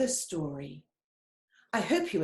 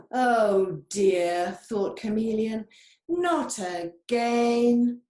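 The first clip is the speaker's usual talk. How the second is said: slow, drawn-out not again; weary sigh; 4 semitones higher, 6 dB louder, slower than usual. Acted. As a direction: groan it out, fed up.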